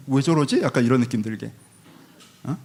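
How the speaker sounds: ordinary talk, nothing marked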